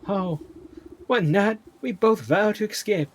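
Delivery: high, loud, indignant Southern belle; Southern accent. Acted. Maiden defending her beloved to Granny, high, loud Southern accent. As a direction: protest in a melodramatic Southern voice. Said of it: "giving her a southern accent".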